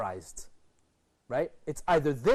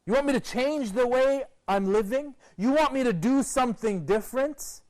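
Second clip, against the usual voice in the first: higher, louder, scornful rhetorical questions; insolent son; 7 semitones higher, 5 dB louder than usual. Acted. A rebellious son throwing scorn back at his parents, loud and higher. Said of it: "He's insulting his parents".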